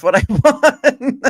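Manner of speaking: slow, laughing through the words. Real, not acted. Friend jokes he won a break, laughing. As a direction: chuckle it out slowly. Joking about winning a break.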